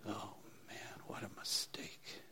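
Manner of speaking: hushed inner groan of regret; barely audible, deflated aside; regretful whisper. Retold or imitated. Inner thought during mediocre service.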